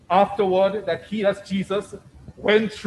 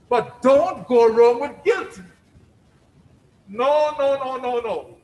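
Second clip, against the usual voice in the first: slow, louder warning, then a rush of no's; emphatic preaching; 5 semitones higher, 6 dB louder, slower than usual. Acted. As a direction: warn the congregation firmly. Preacher rebukes guilt.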